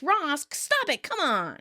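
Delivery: mock freak-out, high and drawn out. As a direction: plead in exaggerated annoyance. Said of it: "Rachel freaks".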